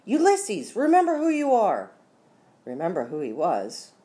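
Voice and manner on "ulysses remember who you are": high, loud rallying call; shouted. A narrator's reading. Flora urges Ulysses to remember himself, high rallying shout. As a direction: call out high to rouse someone. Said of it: "she shouted".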